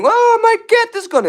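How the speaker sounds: high-pitched, loud acted scream; hammed-up shriek of alarm. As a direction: burst in with a panicked mock scream. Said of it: "runs in screaming".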